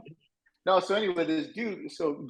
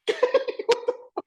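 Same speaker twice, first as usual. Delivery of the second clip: laugh. Retold or imitated. Laughs at friend's knockout win.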